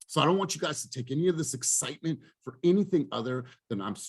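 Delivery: normal talking voice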